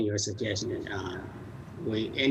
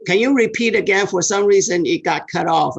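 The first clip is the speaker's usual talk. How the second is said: loud, raised pitch over a bad line; calling into phone; 7 semitones higher, 7 dB louder than usual. Real, not acted. Asking the cut-off client to repeat, loud raised voice. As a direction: push your voice through to the caller.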